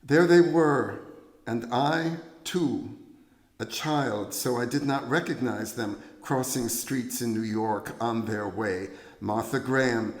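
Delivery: slow, projected poetry reading with lifted pitch; poem recital. Acted. Poet reads A Ballet for Martha to audience, poem recital.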